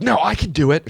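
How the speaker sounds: mock yell, pitched high; retold yelling. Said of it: "She's yelling at the guy".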